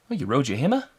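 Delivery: put-on Antipodean accent, rising in questions; Korg. Host imitating a film character asking about the hammer, Australian-style accent. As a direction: play a puzzled rock creature with an Australian-style accent. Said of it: "I think it's Korg from".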